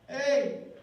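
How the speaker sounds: grito corto, más agudo y fuerte; llama a alguien para que te oiga; llamada de atención. Acted. Imita una llamada tras tocar. (grito para llamar la atención)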